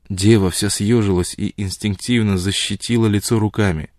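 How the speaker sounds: ordinary delivery, nothing marked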